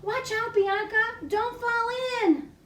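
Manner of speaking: mock alarm for the kids; warning call. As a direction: call out to save the butterfly. Warning butterfly in story.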